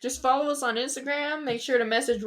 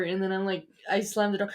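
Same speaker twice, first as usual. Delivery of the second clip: usual speaking voice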